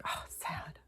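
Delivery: whispered, slow, heavy-hearted; sad whisper. Real, not acted. Vlogger moved by a grieving girl's video, sad whisper. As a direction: breathe it out as a pained whisper.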